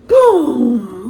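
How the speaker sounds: big booming cannon sound; loud boom. A narrator's reading. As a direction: blast it out. Reading cannon blast.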